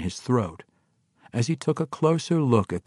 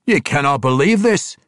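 dwarf voice; 7 semitones higher, 8 dB louder than usual. Acted. Emrys to Conrad.

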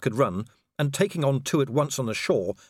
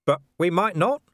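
high, urgent pleading; agitated; 7 semitones higher, 5 dB louder than usual. Acted. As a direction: press the point urgently. Man arguing with Death.

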